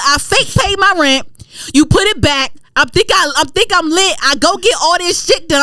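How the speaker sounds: high-pitched indignant rant; mad. Retold or imitated. Tenant confronting bank over rent, mad high-pitched rant. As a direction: rail at them in a high, worked-up voice. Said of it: "I was mad at them".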